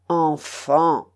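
a drawn-out word with a bitter sneer. A narrator's reading. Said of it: "répliqua le Forban avec un ricanement amer".

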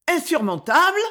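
a sharp cry of disbelief. A narrator's reading. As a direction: cry it out in shock. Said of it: "répéta-t-elle avec un cri".

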